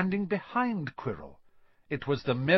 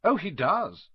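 casually; 6 semitones higher than usual. A narrator's reading. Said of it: "said Quirrell casually".

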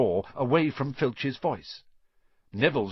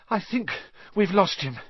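out of breath; panting; 9 semitones higher than usual. A narrator's reading. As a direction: gasp it between breaths. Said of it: "Harry panted".

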